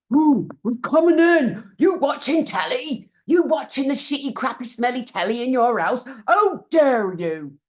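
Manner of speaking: loud, high hammed-up intruder voice; mock the officials barging in, slowing at the end; mocking bully voice. Imagines officials knocking at the door.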